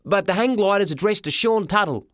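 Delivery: put-on high, protesting young lad; high-pitched boyish objection; boy's voice. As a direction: argue it in a high kid's voice. Boy character argues with porter.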